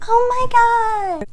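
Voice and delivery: high, loud, shrill-faked outrage at the professor; mocking the classmate. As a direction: squeal it like a dramatic victim, slowing on the last words. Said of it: "those are the words that came out of her mouth".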